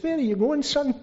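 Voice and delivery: gently voiced elderly churchgoer, high and sweet; Nancy Mackey. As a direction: imitate a pleased old woman, pitched high. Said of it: "she was so pleased. She says".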